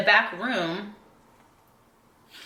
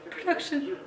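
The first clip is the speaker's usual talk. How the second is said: knowing jab; 10 semitones higher, 6 dB quieter than usual. Retold or imitated. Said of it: "I was like".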